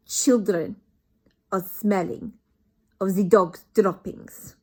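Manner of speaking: put-on loud, slow accented witch voice; Grand High Witch. Grand High Witch lectures her witches, loud slow accent. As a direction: sneer out each word. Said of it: "said the Grand High Witch".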